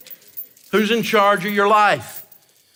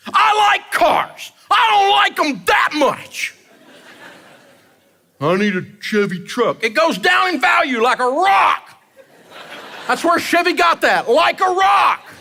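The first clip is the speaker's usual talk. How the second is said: fired up; 10 semitones higher than usual. Real, not acted. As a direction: shout it with a grin. Ranting about truck payments.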